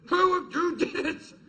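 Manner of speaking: high, drawn-out angry demand; furious mum. Retold or imitated. Furious mum demands to know who made the mess, high-pitched outrage. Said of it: "she's fucking furious".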